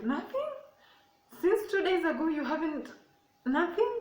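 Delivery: high, loud incredulous cry; outraged disbelief. Retold or imitated. Mom shocked her daughter has had no progress, high loud disbelief. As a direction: mom can't believe nothing has happened.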